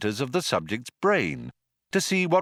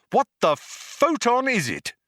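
high, loud demand; impatient raised-voice query; exasperated shout; 12 semitones higher, 4 dB louder than usual. A narrator's reading. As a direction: fire the question out, pitched right up. Zaphod calling out to the computer.

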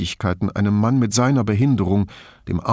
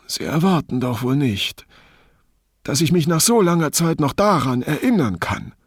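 higher-pitched, testy protest; mildly piqued, voice rising in pitch; slightly irritated; 7 semitones higher than usual. A narrator's reading. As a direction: push back with mild annoyance. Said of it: "in leicht gereiztem Ton".

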